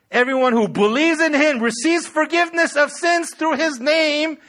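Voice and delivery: loud, high proclamation of scripture; preach. Acted. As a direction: declare the verse with full pulpit fervor. Preaches the verse at full force.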